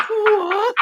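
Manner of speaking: high rising squeak; mock disbelief. Player hearing tomorrow's the finale, squeaking in mock disbelief. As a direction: stretch it up in pretend shock.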